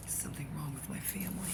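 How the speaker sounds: whispered aside; hushed confession. Real, not acted. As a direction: murmur it like a secret.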